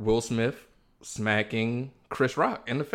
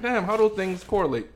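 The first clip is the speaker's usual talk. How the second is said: high-pitched mock bewilderment; imitating puzzled listener; 8 semitones higher than usual. Podcast host mimics listeners questioning his love of violence, high and puzzled.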